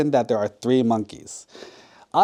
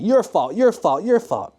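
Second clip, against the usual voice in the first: higher-pitched repeated accusation; mock blaming; 7 semitones higher than usual. Shown as a demonstration. Storyteller showing listeners useless blame, mock accusingly. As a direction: toss blame around in a high, insistent voice. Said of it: "How about we blame somebody".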